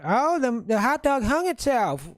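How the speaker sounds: put-on high, sing-song southern belle accent. Comedian gives the hot dog woman a hammed-up southern accent. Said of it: "giving her a southern accent".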